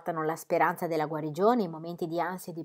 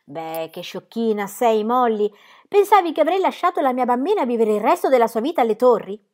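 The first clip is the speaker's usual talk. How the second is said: a louder, lightly teasing father; fond father; 5 semitones higher, 6 dB louder than usual. Acted. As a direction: scold her gently, half laughing. Father teases daughter.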